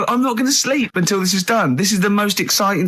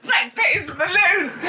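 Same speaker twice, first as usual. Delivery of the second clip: slow, high mock repeat of his words; mocking echo; 11 semitones higher, slower than usual. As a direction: drag it out slowly, teasing him. Mocking Karl's own words.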